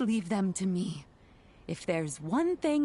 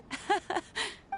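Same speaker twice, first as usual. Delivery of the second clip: soft chuckle; 11 semitones higher, 4 dB quieter than usual. Acted. Granny chuckles over adorable Itto.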